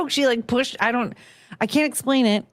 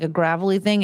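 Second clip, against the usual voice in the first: usual speaking voice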